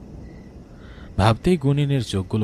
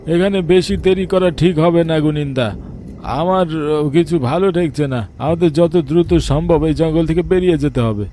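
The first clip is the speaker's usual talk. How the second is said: high, loud urgent plea; urgent villager; 7 semitones higher, 7 dB louder than usual. Acted. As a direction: press them to leave, raised and anxious. Bidhan urges leaving the forest.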